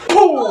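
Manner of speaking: a sharp puff of breath that breaks into a laugh; blowing puff with a laugh. Real, not acted. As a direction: blow out hard, half laughing.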